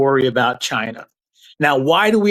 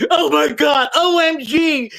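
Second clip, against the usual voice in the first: mock hysterical; 10 semitones higher, slower than usual. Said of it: "watch them go".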